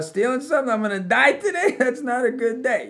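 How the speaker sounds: high, loud incredulous protest; hammed-up outraged bewilderment, played for laughs; comic disbelief. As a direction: pitch it way up in mock alarm. Mock protest at death threat.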